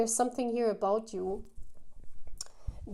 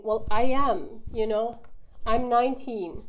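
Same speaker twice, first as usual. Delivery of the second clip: a lightly voiced young person introducing themselves, louder; playful self-introduction; 7 dB louder than usual. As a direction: cheerfully announce your age. Acted introduction, age gap scene.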